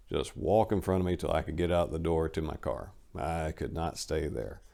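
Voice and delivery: ordinary delivery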